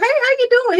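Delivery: high-pitched, friendly mock greeting; pleasant greeting. Woman retelling how she greets her mailman, pleasant. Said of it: "I'm all pleasant".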